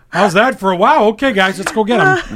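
loud rallying cry. Retold or imitated. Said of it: "I'm like".

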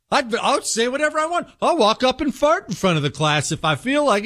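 high, smug show-off voice; cocky confidence. Retold or imitated. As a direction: strut like you can't fail. Jesse gloating with confidence.

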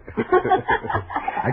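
a drawn-out sly chuckle rising into a high breathy laugh; creepy triumphant laughter; sinister laugh. Acted. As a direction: laugh like a killer savouring the moment. Villain laughs before the toast.

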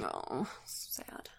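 whisper. Real, not acted. Sad news aside.

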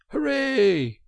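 high, loud cheer; excited cry. A narrator's reading. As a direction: burst out cheering at the top of the voice. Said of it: "Suddenly the Rat cried".

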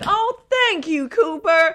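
slow, very high mock gratitude; high-pitched thanks. Driver thanks the man who warned her, high mock gratitude. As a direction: sing out an exaggerated thank-you, ending in a laugh. Retold thanks to helpful stranger.

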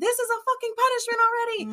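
high, fast, loud bitter retort; retorting in anger. Retold or imitated. Grieving daughter snaps back at father, bitter and fast. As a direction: fire it back at him fast.

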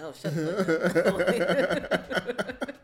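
slow, loud, laughing shut up; laughing retort. Retold or imitated. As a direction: drag it out through a laugh. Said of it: "I'm like".

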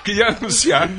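high, bright showman announcing the guest; excited announcer voice. Acted. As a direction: announce him like a game show host.

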